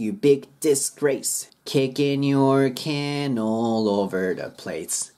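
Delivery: slow rhythmic "Kicking your can all over the place"; silent G linking. Shown as a demonstration. Teacher modelling the next lyrics, slow and linked. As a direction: stretch "You big disgrace" with the G swallowed. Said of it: "G消えます".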